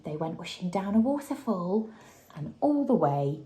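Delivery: plain reading voice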